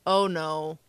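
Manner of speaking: mild dismay, barely voiced up; retold own dismay. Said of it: "I'm like".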